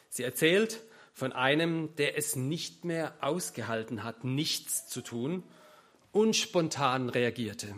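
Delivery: usual speaking voice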